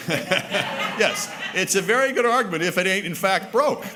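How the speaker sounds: real laughter while agreeing with the joke; laughing. Real, not acted. Laughing as a guest jokes it's broke. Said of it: "It's broke".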